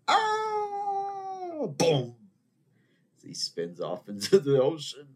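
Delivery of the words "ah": very high mock scream, held; imitated falling scream again. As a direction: redo the propeller man's wail. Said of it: "you hear him screaming".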